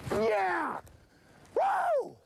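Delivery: very high victory yell; triumphant whoop. Real, not acted. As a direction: whoop like you just won. Fish landed, winning.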